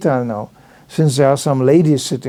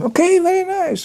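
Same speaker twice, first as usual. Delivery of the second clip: light friendly brush-off; 13 semitones higher than usual. Retold or imitated.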